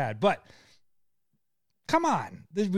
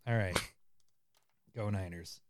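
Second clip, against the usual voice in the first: repeated much softer and lower; quiet; 8 semitones lower, 11 dB quieter than usual. Shown as a demonstration. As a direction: mutter it under your breath. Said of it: "He said".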